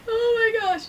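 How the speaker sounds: high, loud squeal of overwhelm; gasping "oh my gosh". Retold or imitated. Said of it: "I'm like".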